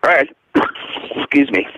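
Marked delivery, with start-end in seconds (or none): 0.5-1.1 rough hacking cough; smoker's cough. Real, not acted. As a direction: cough it out hoarse and dry. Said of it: "I have a smoker's cough".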